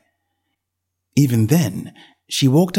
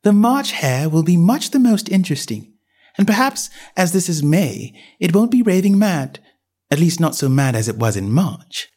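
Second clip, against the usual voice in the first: put-on young girl voice; 6 semitones higher than usual. A narrator's reading. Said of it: "she said to herself".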